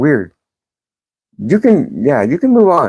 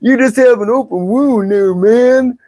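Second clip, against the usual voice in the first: a lightly voiced mocking troll, pitched higher; mild mock voice of an online commenter; 7 semitones higher than usual. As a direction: mimic the keyboard troll with a sneer. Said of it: "hide behind a keyboard going".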